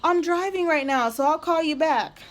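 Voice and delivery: high, bubbly fake-friendly voice; mock sugary saleswoman. Mocking the saleswoman who signed her up, high fake-cheery voice. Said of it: "then she's like".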